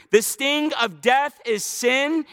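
ordinary delivery

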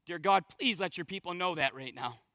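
drops low and soft into a prayer; quiet prayer. Real, not acted. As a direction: pray it under your breath, earnest. Sudden prayer mid sermon.

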